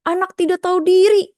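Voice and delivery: high, loud accusing snap; judgmental scolding. Shown as a demonstration. Teacher demonstrating a judgmental insult toward a child. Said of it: "nggak boleh tuh kayak gitu".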